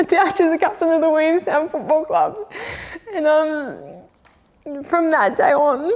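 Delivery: high, teary voice breaking into laughs; choked up with pride. Real, not acted. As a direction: fight back tears while praising her. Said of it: "This makes me emotional".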